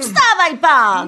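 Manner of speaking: slow, loud wake-up call; shouting. Acted. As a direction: drag him awake with your voice. Cleaner rousing drunk man.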